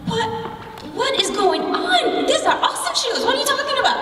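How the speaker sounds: put-on very high, incredulous kid protest; indignant disbelief. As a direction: squeal it, baffled and defensive, half laughing. Acted stunned reply to teasing.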